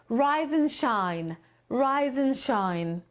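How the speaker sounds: "Rise and shine" slowly with and reduced to n; linked rise and shine. Shown as a demonstration. Teacher showing students how and shrinks to n. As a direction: glide "Rise and shine" as one word, rize-n-shine. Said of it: "to wymawiamy jako jedno".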